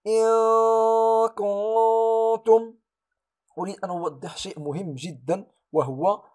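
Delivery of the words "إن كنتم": إن كنتم with nun hidden in a long high nasal hum, very slow. Shown as a demonstration. Teacher reciting the hidden nun, slow and high. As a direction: recite إن كنتم with drawn-out ikhfa. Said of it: "أقول".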